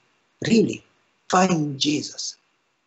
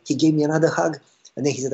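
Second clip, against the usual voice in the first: ordinary talk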